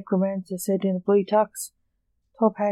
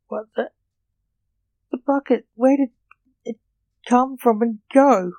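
higher-pitched, mildly baffled outburst; understated fit; 5 semitones higher, 4 dB louder than usual. A narrator's reading. John asks Blake about the vanishing bucket, having a mild fit. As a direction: narrate a small fit of disbelief. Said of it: "having a fit".

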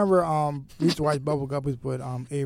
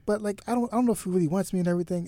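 higher-pitched imitation of young girls; playful retelling in a higher voice; girls quoted; 6 semitones higher than usual. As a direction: lightly mimic girls fretting over a boy. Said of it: "the girls was like".

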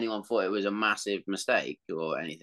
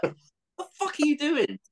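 exasperated yell at the TV; 13 semitones higher than usual. Retold or imitated. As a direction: yell at the player on screen in disbelief. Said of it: "I was like".

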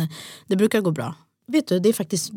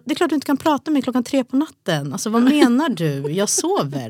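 higher-pitched, incredulous protest; mock exasperation; 7 semitones higher, 4 dB louder than usual. Woman to partner about night-time talks, mock exasperated. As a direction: act out telling off a partner, indignant but playful.

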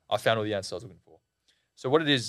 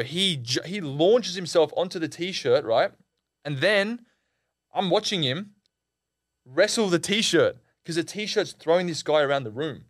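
plain reading voice

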